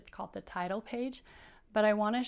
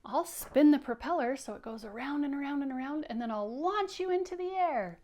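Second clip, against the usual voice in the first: a higher, eager Rabbit voice; 5 semitones higher, 4 dB louder than usual. Acted. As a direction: pitch it up as the keen rabbit. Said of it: "Rabbit says".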